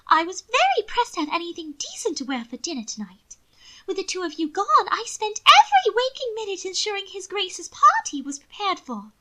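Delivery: affected lady. A narrator's reading. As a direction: prattle on like a vain aristocratic lady. Mina voiced, high and affected.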